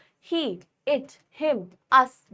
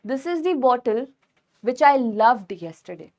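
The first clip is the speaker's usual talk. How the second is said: slow dictation; much slower than usual. Shown as a demonstration. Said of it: "example sentence".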